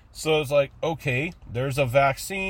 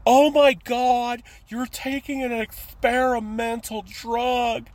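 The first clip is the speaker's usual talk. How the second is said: higher, louder hammed-up panic; mock horror, much higher and louder; mocking alarmed critic; 10 semitones higher, 3 dB louder, slower than usual. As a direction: gasp it like a scandalised friend. Mocking vaccine critics' backlash.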